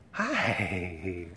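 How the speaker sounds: usual speaking voice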